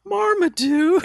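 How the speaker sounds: slow, high drawn-out name; mock announcing a name; drawn-out high call.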